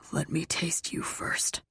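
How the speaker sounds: soft, husky male murmur; lover's whisper. A narrator's reading. Lover on the mattress asking her, soft whisper. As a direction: whisper it as an intimate request.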